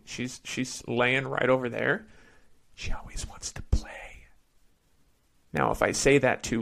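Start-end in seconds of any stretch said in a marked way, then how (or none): 2.7-4.2 whispered so the dog won't hear; hushed whisper, barely voiced. Real, not acted. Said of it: "So I have to whisper the word play".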